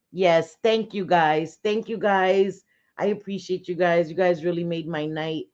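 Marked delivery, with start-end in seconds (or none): none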